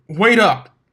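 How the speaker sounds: loud, high shout; shouting after her. A narrator's reading. Blitz shouts after Dash racing up the mountain, loud and high. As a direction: yell for her to wait.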